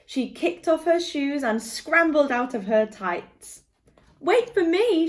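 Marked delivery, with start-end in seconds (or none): 4.2-5.1 high eager call. A narrator's reading. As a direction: cry out after them, high and bright. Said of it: "she cried".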